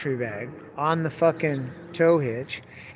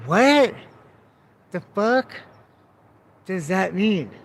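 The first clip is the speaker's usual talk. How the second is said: incredulous outburst; stunned disbelief; 2 semitones higher, slower than usual. Retold or imitated. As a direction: blurt it out, totally thrown. Baffled by smelly bag.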